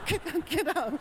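mock flustered friend urging you up; embarrassed friend.

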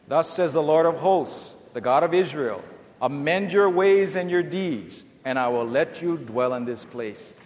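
congregational reading aloud. Real, not acted. Pastor leading the church in reading Jeremiah, loud and measured. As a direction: read it out together like a congregation. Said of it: "Let's read Jeremiah seven together".

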